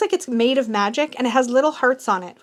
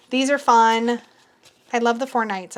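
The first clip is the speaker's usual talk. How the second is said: normal talking voice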